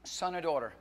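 loud, slow, drawn-out preaching; preaching shout. Acted. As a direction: belt the verse from the pulpit. Preaching scripture at full force.

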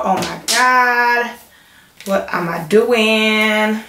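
slow, stretched-out wail of dismay; drawn-out dismay. Real, not acted. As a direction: drag out every word in dismay. Dismayed at wet floor near electricals.

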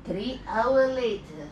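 very slow, drawn-out announcer voice; hammed-up, leisurely time-skip announcement; cartoon time-card narrator.

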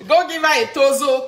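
笑いながら言う; laughing order. Acted.

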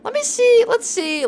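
very high, squeaky and stammering; high-pitched panicked repetition; rattled after a scare. Real, not acted. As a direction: squeal it out, still reeling from fright. Rattled right after jump scare.

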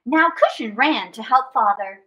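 ordinary delivery

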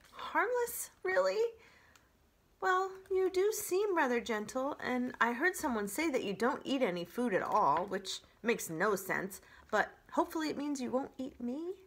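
high-pitched, hesitant small animal; nervous opossum. Acted. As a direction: sound wary but wanting to believe. Nervous opossum questions the robot.

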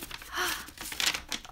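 soft breathy gasp of delight; quick delighted intake of breath. Real, not acted. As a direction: gasp with pleasure. Sees cute fan drawing.

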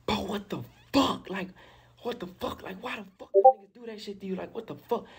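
high-pitched mock sobbing, volume jumping; imitated crying. Friend seeing his wounded friend in the chair, imitated crying. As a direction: imitate a friend bursting into tears. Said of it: "he bust out crying".

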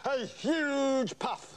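very slow, stretched out; drawn-out emphasis. Acted. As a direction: stretch every word grandly. Showing off the puff.

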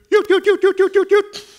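imitated shepherd's high yoot call. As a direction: call the sheep the way their shepherd does. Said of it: "he entered and he goes".

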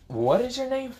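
very high, incredulous question. Acted. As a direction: ask in a squeaky high pitch. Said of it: "Free kinda questioned Issei".

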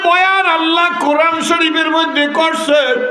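melodic waz preaching. Acted. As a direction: preach in a sustained singing cry, stretching the phrase endings. (সুর করে উচ্চস্বরে ওয়াজ)

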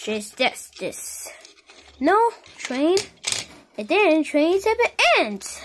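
usual speaking voice

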